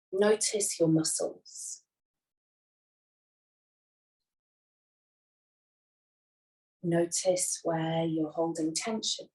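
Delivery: unhurried, soothing yoga nidra voice; guided meditation. Acted. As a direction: guide them gently into rest, leaving long silences. Leads a guided relaxation.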